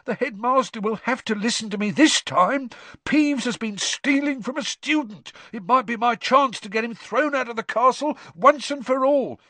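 high, eager whine; whiny caretaker. A narrator's reading. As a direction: whine with hope of revenge. Filch whines about Peeves.

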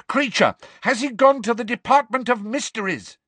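loud high yell. Acted. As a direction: shout after the departing elf. Harry still yelling.

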